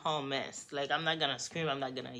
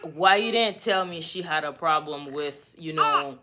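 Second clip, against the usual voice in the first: half-laughing, louder retelling; 7 dB louder than usual. Retold or imitated. Woman confronting boyfriend about the texts, laughing. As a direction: crack up while asking why. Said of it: "Yes, mama".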